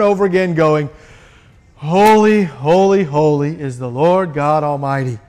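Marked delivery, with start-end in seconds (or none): 1.7-5.2 mechanical chant, each holy the same; rote incantation. Shown as a demonstration. Preacher mocking rote worship, droning empty repetition. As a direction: recite it like a dull ritual, going through the motions. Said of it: "this is not a repeated incantation".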